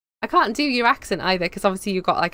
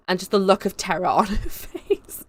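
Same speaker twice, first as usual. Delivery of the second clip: normal talking voice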